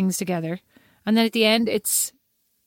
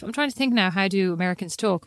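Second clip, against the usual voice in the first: ordinary talk, nothing marked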